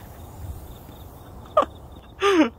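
high airy giggle; breathless laugh. Real, not acted. Laughing after getting splashed.

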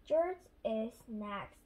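ordinary delivery, nothing marked

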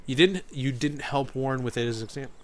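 ordinary delivery

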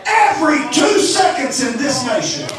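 preaching at full force. Acted. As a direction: hammer each second like a clock striking. Counting seconds to eternity.

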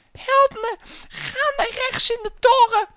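very high, loud mock damsel cry; falsetto princess. Princess directing player to tower, falsetto. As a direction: squeal directions in a put-on girly falsetto.

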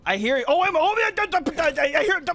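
high, panicky stutter; flustered stuttering. Real, not acted. Player unsure what to do, flustered stuttering. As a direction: trip over the first words, pitch jumping up. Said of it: "Okay, I'm stuttering".

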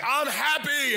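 high mock shout of joy; imitating a fierce happy holler; angry-sounding happy. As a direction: insist you're happy as if yelling. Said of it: "Is that anger?".

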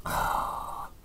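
long, soft, voiceless exhale; contented sigh. Real, not acted. Beer reviewer after a swallow, contented sigh. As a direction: let out a long breath after a drink.

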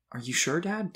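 put-on high, rising child's question; hopeful. A narrator's reading. Boy asks his dad about Santa, hopefully. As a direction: ask Dad with tentative hope. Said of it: "Tim asked hopefully".

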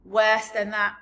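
loud, amused giant cry; booming giant amusement; roaring with laughter. A narrator's reading. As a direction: crow it with mock-hearty mirth. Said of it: "roaring with laughter".

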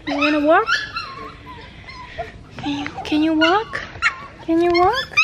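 high, rising coaxing voice; coaxing the puppy. Real, not acted. Owner coaxing the hurt puppy to walk, high and gentle.

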